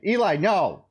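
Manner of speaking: firm parental yell across the room; calling out sharply. Real, not acted. Yelling at child mid-stream.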